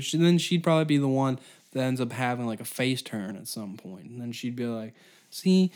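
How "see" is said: loud, high, excited heroine declaration; raised, over-keen save-the-day voice; mock heroine. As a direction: proclaim it like a hammy hero. Imagined Grimes turning heroine.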